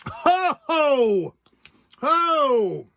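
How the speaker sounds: high yelping ho ho from the sour taste; sour candy shock. Real, not acted. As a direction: yelp at the sour hit. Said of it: "That's sour".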